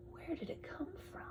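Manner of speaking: high, soft whisper of wonder; hushed question full of wonder. Acted. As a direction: breathe it out in awe.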